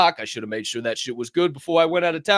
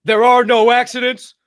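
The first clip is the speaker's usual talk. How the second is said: slow, rising, louder, higher disbelief; mocking echo that builds louder; incredulous echo; 8 semitones higher, 10 dB louder, slower than usual. Real, not acted. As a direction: throw the phrase back, climbing in disbelief. Mocking the host's claim, rising.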